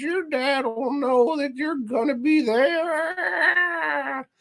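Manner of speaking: high, meowing cat-like voice; cat lady voice. Retold or imitated. Cat lady answering the boy, high and catlike. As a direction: squeak it out like a cat. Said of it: "she sounds like a cat".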